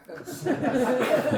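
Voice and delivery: real high-pitched laugh. Real, not acted. Teacher is thanked by a listener, amused laugh.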